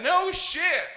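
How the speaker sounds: high, loud mock surprise; surprised sergeant. Sergeant reacting to Palestine, surprised. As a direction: blurt it out surprised. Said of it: "He's like".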